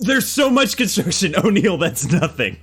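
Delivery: high-pitched, laughing exasperation; exasperated protest. Real, not acted. Player to game master over a useless lead, exasperated.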